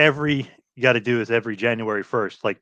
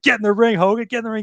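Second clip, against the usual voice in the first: high, worked-up wrestling commentator; Dusty commentating; 10 semitones higher, faster than usual. Retold or imitated. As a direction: egg him on from the announce desk. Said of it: "Dusty was so great. He's like".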